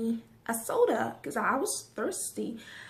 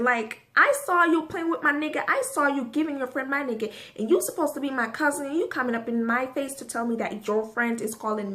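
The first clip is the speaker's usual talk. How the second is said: retold angry confrontation; 2 semitones higher, 5 dB louder, faster than usual. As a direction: tear into her, louder with each line. Confronting cousin who came at her.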